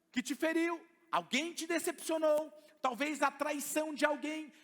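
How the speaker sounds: loud, high preaching to the church; full-force preaching. Acted. Pastor exhorting the congregation, loud and high. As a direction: preach it out with power.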